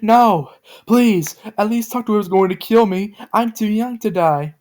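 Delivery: high, loud desperate begging; pleading shout near tears. A narrator's reading. Joe begging the voice not to kill her, high and tearful. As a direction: beg for your life, close to crying. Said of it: "Joe shouted while becoming sad like she was going to cry".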